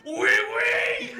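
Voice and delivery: very high shouted French waiter. Retold or imitated. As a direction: shriek it like an intense French waiter. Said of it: "Alex's voice for the guy was like".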